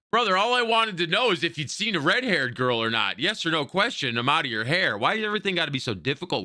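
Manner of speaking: high-pitched exasperated plea; fed-up griping at someone who won't answer. Real, not acted. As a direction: plead in frustration, voice riding up. Player fed up with game character.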